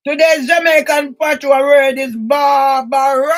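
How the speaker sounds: slow, loud announcer call; loud announcement. Real, not acted. Presenting the word of the day, loud slow announcement. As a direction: announce it slowly to the viewers.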